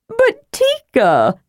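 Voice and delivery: high, slow, drawn-out protest; whiny protest. Acted. Tass protesting to Tika, high and drawn out. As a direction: stretch it out like a kid objecting.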